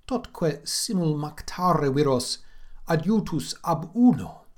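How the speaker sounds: slow, dramatic reading of Latin verse; measured Latin verse recital; poem recitation. Acted. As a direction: declaim it like a lament.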